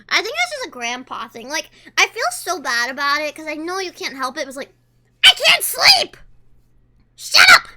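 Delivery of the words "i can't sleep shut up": high, loud retold outburst; hammed-up sleepless shouting; exasperated yell. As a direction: yell at a snorer, mock fury. Acting out yelling at a snorer.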